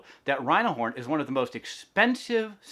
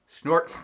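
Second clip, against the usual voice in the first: loud snort through the nose; rhino snort; 5 semitones higher, 7 dB louder than usual. Acted. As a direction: snort like a grumpy calf. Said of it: "She made funny sounds".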